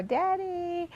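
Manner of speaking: put-on high, drawn-out child's call for dad; little one calling. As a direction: imitate a small kid calling out, high-pitched. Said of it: "my little one was calling".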